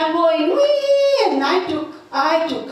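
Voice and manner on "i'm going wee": wee sung out high and slow; gleeful wee. Retold or imitated. Driving off from the wrecked yard, a high drawn-out wee. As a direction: stretch the wee up high like a kid on a slide. Fleeing the crash scene.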